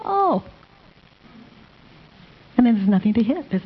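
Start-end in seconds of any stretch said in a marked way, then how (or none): 0.0-0.4 put-on child's sudden realization. Child's reply voiced by mother.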